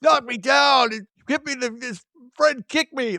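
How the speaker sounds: high, whiny complaining voice; whiny Cheech. Retold or imitated. Cheech whining to the bartender about being beaten up, high whiny voice. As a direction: whine it like a beaten-up man. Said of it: "he's all whiny".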